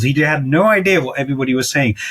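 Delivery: ordinary talk, nothing marked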